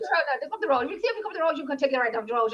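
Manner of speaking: high, tumbling Irish country gabble; mock Irish accent, words running together; mimicked Irish country accent. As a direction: give directions in a thick rural Irish accent. Imitates incomprehensible country directions.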